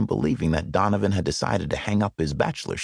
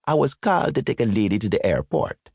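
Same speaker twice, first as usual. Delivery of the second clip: put-on lightly done Jamaican accent; easy Jamaican cabbie explaining; 3 semitones higher than usual. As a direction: Jamaican driver protesting mildly. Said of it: "with a deep Jamaican accent".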